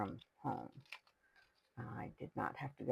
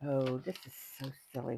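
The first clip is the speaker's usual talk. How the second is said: ordinary talk, nothing marked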